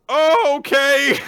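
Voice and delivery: high, loud, slow squeak; squeaky surprise. Retold or imitated. Flustered boy answering her, high and loud. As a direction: yelp it out startled. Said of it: "he goes".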